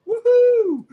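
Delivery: very high, loud "woohoo"; gleeful whoop. Retold or imitated. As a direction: whoop like Homer Simpson jumping in. Imitating gleeful jump into police van.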